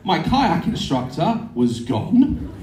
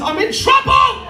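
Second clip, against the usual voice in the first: very high, desperate mock shout; screaming for help. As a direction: shriek it to people who misunderstand you. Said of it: "I'm screaming at them".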